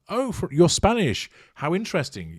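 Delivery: high-pitched imitation of an impressed listener; mock fascination. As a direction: pretend to be intrigued, pitch lifted. Mimics people reacting to her claim.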